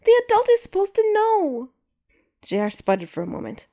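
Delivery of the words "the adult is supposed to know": screeching. A narrator's reading. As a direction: screech it, stomping her foot. Girl keeps scolding.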